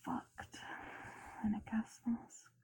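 muffled whisper behind a mask; through a gas mask. Real, not acted. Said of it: "Does my voice sound much different now?".

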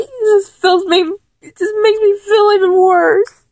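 high, tearful voice with sobs in it; weepy high plea; crying. Acted. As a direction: choke the words out through tears.

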